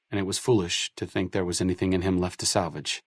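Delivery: ordinary talk, nothing marked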